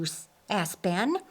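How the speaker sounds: plain reading voice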